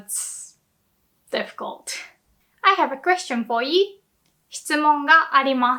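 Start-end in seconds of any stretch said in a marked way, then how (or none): 0.0-1.9 whispered aside. Real, not acted.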